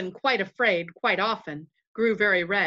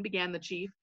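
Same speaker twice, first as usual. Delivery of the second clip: plain reading voice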